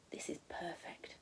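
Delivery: soft breathy whisper; hushed delighted whisper. Acted. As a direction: breathe it out in awe. Sophie delighted by food tower.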